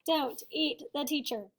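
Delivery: ordinary delivery, nothing marked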